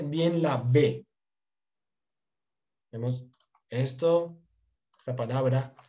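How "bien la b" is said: drawn out, slow emphasis on bien la B; slow down and land on bien la B. Shown as a demonstration. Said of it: "pronuncien bien". (despacio, marcando bien la B)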